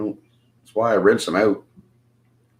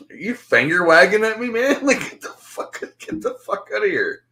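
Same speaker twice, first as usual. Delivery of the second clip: outraged retort; 10 semitones higher than usual. Retold or imitated. Acting out outraged retort to stranger.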